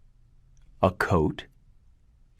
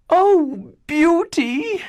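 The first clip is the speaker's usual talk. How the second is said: put-on very high, loud wail of the older sisters; older sisters scolding their youngest sister; shrill sister cry; 5 dB louder, much slower than usual. A narrator's reading. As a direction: cry out in shock as the spiteful sisters. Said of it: "cried the older sisters".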